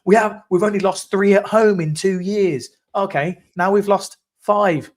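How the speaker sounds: high, loud fake panic; mock panicked fan. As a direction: ham up a worried fan. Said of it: "and everyone's like".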